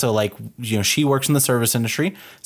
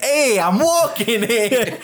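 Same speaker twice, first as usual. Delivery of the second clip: much higher, slow hammed-up New York accent; New York tough guy; 10 semitones higher, much slower than usual. Mock New York accent as she bumps into someone. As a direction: stretch it out like a brash New Yorker. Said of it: "she bumps into somebody and she's like".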